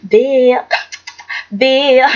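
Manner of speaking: lightly voiced scheming rival, pitched low; mock her plotting in a low voice.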